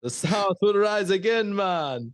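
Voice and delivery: mock Jamaican lilt on a Southern line; Jamaican-ish Southern. Imitating Joey's Southern accent turning Jamaican. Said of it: "his Southern accent just comes out Jamaican".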